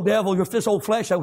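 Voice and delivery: ordinary talk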